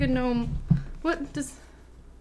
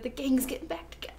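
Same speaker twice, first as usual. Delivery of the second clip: soft whispered aside; 10 dB quieter than usual. Real, not acted. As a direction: whisper it with hushed excitement.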